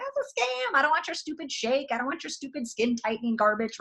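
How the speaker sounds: high-pitched, whiny-style dismissal; mock scorn. Wife replays refusing her husband's suggestion, mock high-pitched scorn. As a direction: imitate your old self scoffing in a high voice.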